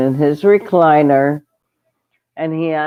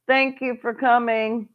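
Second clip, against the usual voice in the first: ordinary talk, nothing marked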